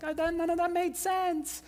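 high, drawn-out mock confusion; baffled reaction. Preacher mimics a confused congregation, high baffled whine. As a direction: whine it like a lost listener.

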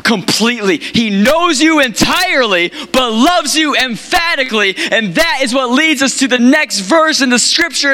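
fervent, high-pitched sermon delivery; impassioned preaching. Real, not acted. Preacher rallying a congregation to approach God boldly, fervent. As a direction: preach with rising pitch and urgency.

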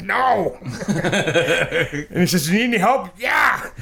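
high laughing replies; giggling through the dialogue; laughing retelling. Retold or imitated. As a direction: laugh through the quoted answers. Retold exchange after fall, laughing.

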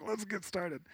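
high, soft sing-out, retold; imitated high, light prompt; hurrying someone along. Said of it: "I'm running behind".

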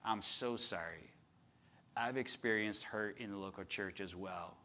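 soft, low, sincere apology. Real, not acted. Pastor apologising to church-hurt people, soft sincere tone. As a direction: say sorry gently to the hurt. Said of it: "to say to you".

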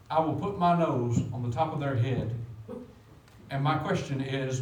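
ordinary talk, nothing marked

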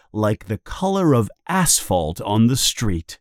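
ordinary delivery, nothing marked

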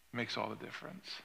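preacher's quiet echo of his point; soft echo. Acted. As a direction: let the line fall away quietly. Repeated softer for effect.

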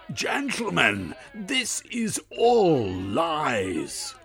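high, loud and drawn out slowly; loud protest. Acted. Blackanor appealing to the gentlemen, loud, high and slow. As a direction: spell out the denial to the room. Blackanor pleads to club members.

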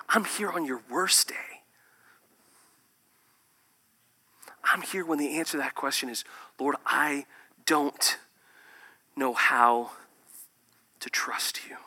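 soft, hushed, comforting words; tender whisper. Acted. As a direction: whisper like consoling a friend. Jesus speaking tenderly.

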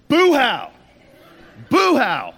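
loud, high-pitched re-enacted yell; angry yelling. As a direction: shout it out like an angry man on a bus. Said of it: "So I just start yelling on this bus".

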